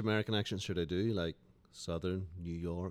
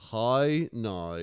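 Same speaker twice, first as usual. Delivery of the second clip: Northern Irish accent; 3 semitones higher, 5 dB louder than usual. Shown as a demonstration. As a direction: demonstrate the Northern Irish vowel in "How now". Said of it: "how to do a Northern Irish accent".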